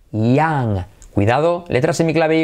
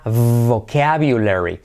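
slow model pronunciation; 5 semitones lower, slower than usual. Shown as a demonstration.

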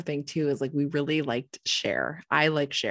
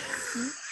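high airy laugh after the punchline; breathless laugh; 9 semitones higher, 9 dB quieter than usual. Real, not acted. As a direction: let a breathy giggle escape.

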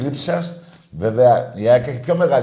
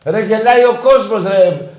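louder exasperated repeat; 9 semitones higher, 6 dB louder than usual. Real, not acted.

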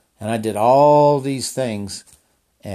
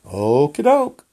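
slow, loud and higher, breezily going along; breezy okie doke; 5 semitones higher, 5 dB louder, slower than usual. Retold or imitated. Answering the boastful rider, a slow, loud, breezy okie doke. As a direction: toss off an easygoing yes. Casually agreeing to a challenger.

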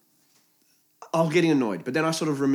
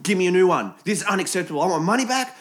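raised, higher mock complaint; demanding customer; 6 semitones higher, 3 dB louder, faster than usual. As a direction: play an outraged customer making demands. Acts hypothetical demanding customer.

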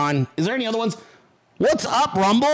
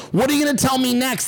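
normal talking voice

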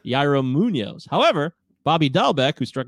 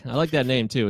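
ordinary delivery

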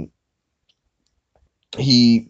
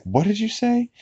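imitated affronted reply; 13 semitones higher, 3 dB quieter than usual. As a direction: let the question shoot up in mock disbelief. Acted affronted reply to accusation.

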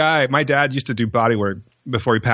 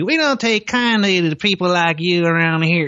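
Southern movie threat; 4 semitones higher than usual. Retold or imitated. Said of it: "how many times did you hear".

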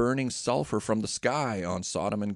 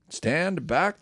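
higher, mock mob shout; shouted command; 6 semitones higher than usual. A narrator's reading. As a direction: bark it at him like a crowd. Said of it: "they shouted".

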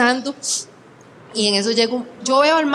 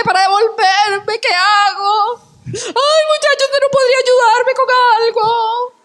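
pleading beggar woman; 9 semitones higher than usual. Retold or imitated. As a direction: overact a pitiful stranger begging for bus fare. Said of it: "me dice". (mujer que pide plata para el pasaje, voz aguda y quejumbrosa exagerada)

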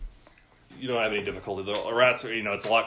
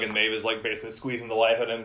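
ordinary delivery

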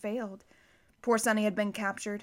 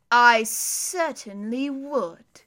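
slow, louder villain drawl; sinister villain; 7 dB louder, much slower than usual. A narrator's reading. Count Olaf sneering at the children, slow and sinister. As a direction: savour every word like a villain.

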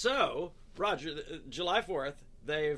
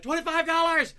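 very high, loud, strained squeal; frantic bidder; 13 semitones higher, 10 dB louder than usual. Retold or imitated. Frantic bidder blurting a bid in a squeaky voice. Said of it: "finally he says something like".

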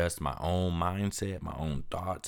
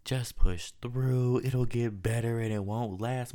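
higher, slow, sing-song mock of empty comfort; mocking well-wishers; 6 semitones higher, slower than usual. Fed-up podcaster mimicking people who tell him to push through, mocking and higher. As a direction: drag out the advice like a sarcastic parrot. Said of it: "if one more motherfucker tells me".

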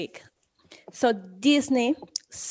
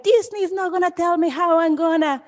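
higher, indignant mock Karen voice; huffy entitled mom complaining about Disney; 5 semitones higher than usual. Podcast host imitating complaining Karens, indignant higher voice. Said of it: "all the Karens in the world are saying".

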